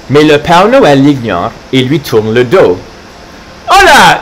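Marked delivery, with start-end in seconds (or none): 3.7-4.2 much higher, louder "Hola!"; yelling. Acted. Carlo yelling after Santa, loud call. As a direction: holler to stop him. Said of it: "hurle Carlo".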